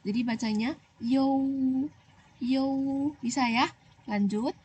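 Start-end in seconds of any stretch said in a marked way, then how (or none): 1.0-2.7 "you" said slowly with a small o sound slipped in before the u; careful you. Shown as a demonstration. Teacher drilling pinyin for pupils, slow careful "you". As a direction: glide into "you" through a hidden little o. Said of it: "harus seperti ada huruf o kecil di sini ya".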